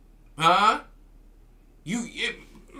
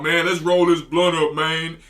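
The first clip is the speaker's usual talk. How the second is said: imitating a woman talking like a man, loud; gruff slangy quote, put on; mock street talk; 2 semitones lower, 6 dB louder than usual. Preacher mocking women who talk about rolling blunts. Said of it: "Talking about".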